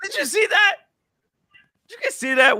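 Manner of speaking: very high, incredulous questions; real high-pitched astonishment; amazed. Real, not acted. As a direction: check everyone saw it, voice shooting up. Amazed at expert appearing in video.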